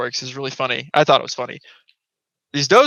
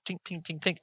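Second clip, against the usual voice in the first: very soft, breathy tink tink; imitated antler clicks; 4 semitones higher, 14 dB quieter than usual. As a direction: tap out the click of antlers on wood under your breath. Imitating antlers hitting dry wood.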